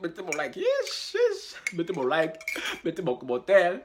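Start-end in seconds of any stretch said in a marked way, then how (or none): 0.6-1.5 very high squeaky yish; high squeal. Real, not acted.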